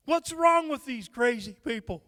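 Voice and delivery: usual speaking voice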